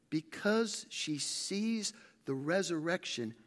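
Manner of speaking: usual speaking voice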